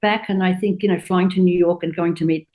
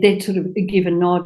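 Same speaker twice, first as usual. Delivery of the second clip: ordinary talk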